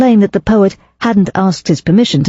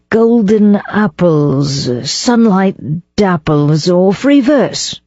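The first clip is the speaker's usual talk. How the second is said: slow, musing, tasting each phrase; dreamy recitation; 2 semitones higher, much slower than usual. Acted. Poet trying out verse for his fiancée, slow and dreamy. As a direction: savour the rhyme like a poet composing.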